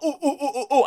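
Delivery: imitated caveman-style oohs; mock gibberish hooting. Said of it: "I'm not saying ooga booga".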